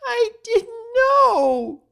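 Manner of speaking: very loud, slow, sobbing wail; crying shout. Acted. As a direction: break down in tears. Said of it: "she cried".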